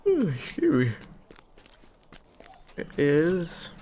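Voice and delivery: a high sleepy yawn. Real, not acted. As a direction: yawn through the words. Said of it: "sorry if you heard me yawn".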